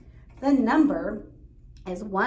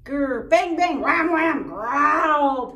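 loud high shouted monster noises; yelling; 7 semitones higher, 11 dB louder, slower than usual. Acted. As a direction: yell the sounds out wildly. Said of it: "Pig yelled".

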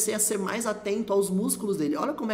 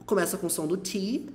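normal talking voice

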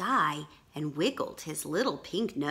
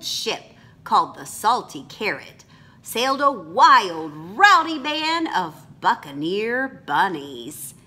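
bright, lively storybook reading; animated read-aloud for children; lively story reading; 4 semitones higher, 5 dB louder than usual. A narrator's reading. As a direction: read it like an adventure to kids.

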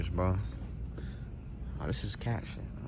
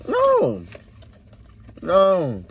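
loud firm no; 7 semitones higher, 6 dB louder than usual. Retold or imitated. Firmly refusing the imagined request.

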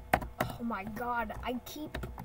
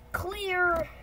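higher, louder "clear!"; mock surgeon's call; 5 semitones higher, 6 dB louder than usual. Stick surgeon before shocking the patient, mock shout. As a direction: call it out over the defibrillator.

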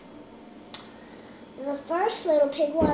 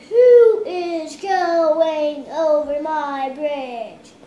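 loud, slow troll voice; booming slow troll; 13 dB louder, much slower than usual. Acted. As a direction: boom it out like a troll. Troll voice in child's story.

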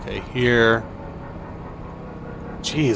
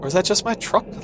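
puzzled; 8 semitones higher than usual. Real, not acted. Hull breach appears suddenly.

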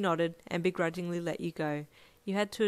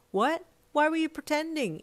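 sharp, higher, louder confusion; confused; 10 semitones higher, 5 dB louder than usual. A narrator's reading. Ichiro reacting to surprising news, shot back confused. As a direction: snap back baffled. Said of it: "Ichiro shot back, confused".

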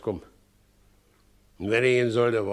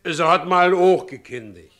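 louder, higher, fed-up complaint; exasperated outburst; 8 semitones higher, 6 dB louder than usual. Acted. Widower complains to his friend, exasperated and louder. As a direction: throw up your hands in frustration.

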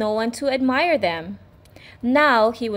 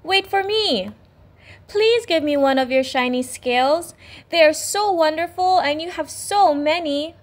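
high, pleading little fish; small fish voice; 6 semitones higher than usual. Acted. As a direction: plead for a shiny scale.

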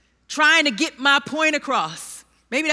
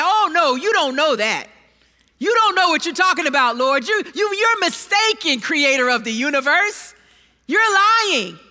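louder, brash mock denial of the Lord; arrogant protest; 3 dB louder than usual. Preacher voicing Peter contradicting Jesus, brash and indignant. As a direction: scoff at Jesus as if he's wrong.

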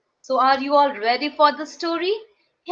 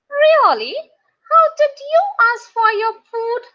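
put-on high, louder, quick question in a boy's voice; Peter; 8 semitones higher, 5 dB louder, faster than usual. A narrator's reading. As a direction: pitch it up as a curious schoolboy. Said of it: "asked Peter".

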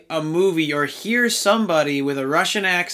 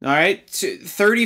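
ordinary delivery

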